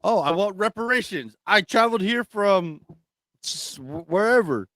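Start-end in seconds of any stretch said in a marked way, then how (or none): none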